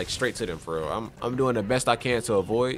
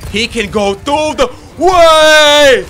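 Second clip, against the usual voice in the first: slow, high, loud shout; shocked gamer shout; panicked shout; 12 semitones higher, 14 dB louder, much slower than usual. Real, not acted. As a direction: yell it in disbelief. Panicked at enemy breaking through.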